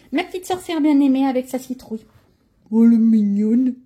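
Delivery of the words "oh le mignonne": slow, louder, cooing "oh"; cooing at something cute. Real, not acted. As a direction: coo over a cute drawing. Admires the little witch drawing.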